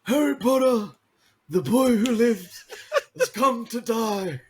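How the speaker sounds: very high, slow, laughing villain voice; Peter Dutton impression. Mate doing a Peter Dutton impression, high and giggly. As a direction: squeal it out like a cartoon villain, cracking up. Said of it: "that was [name]'s Peter Dutton".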